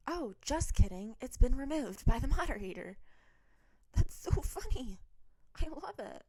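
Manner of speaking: high, loud, tickled reaction; amused surprise. Real, not acted. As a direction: burst out, real amusement at a surprise. Amused surprise at story being removed.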